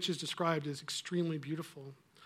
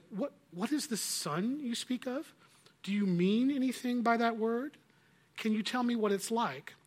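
higher, coaxing questions; mock-curious witch; 6 semitones higher, 4 dB louder than usual. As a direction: feign innocent curiosity. Witch questions the children mockingly.

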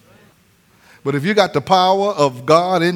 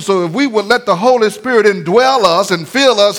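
ordinary talk, nothing marked

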